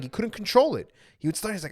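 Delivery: ordinary talk, nothing marked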